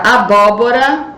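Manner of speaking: "abóbora" com BÓ aberto e destacado; sílaba tônica aberta. Shown as a demonstration. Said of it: "som aberto no primeiro O". ("abóbora" articulado, marcando o BÓ)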